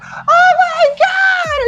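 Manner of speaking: very high, slow shrieking fangirl; swooning fan scream. Acted. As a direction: scream like she's about to faint. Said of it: "a female Dragonborn lady who's like".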